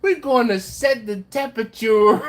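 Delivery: high, loud mimicked accent, close to laughing; teasing accent impression on "temperature"; imitating Jimmy's accent. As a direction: imitate his accent, leaning on the odd word. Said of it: "Jimmy's like".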